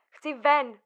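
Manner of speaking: desperate plea. Acted. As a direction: push it out, begging to be let go.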